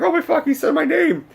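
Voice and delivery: very high-pitched fan freak-out; starstruck squeal. Retold or imitated. Fan reacts to his hero saying his name, starstruck squeal. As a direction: squeal it in mock overwhelmed awe.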